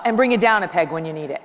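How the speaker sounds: ordinary talk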